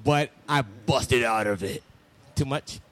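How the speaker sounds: deep wrestler voice; Triple H voice. Acted. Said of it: "That's my, it's my wrestler voice".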